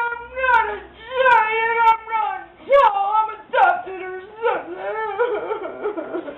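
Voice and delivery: put-on high, slow crying giant boy. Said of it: "he was crying and he was going".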